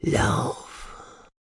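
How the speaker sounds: soft, voiceless whisper. A narrator's reading. Cassian secretly tells Marv to run, whispered. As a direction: breathe it right into the ear. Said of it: "flüsterte er".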